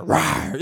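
playful roar. Pretend. Mimics a lion or tiger.

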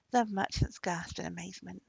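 usual speaking voice

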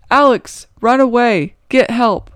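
woman screaming at Alex to run; desperate scream. Acted. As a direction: scream to warn Alex to flee.

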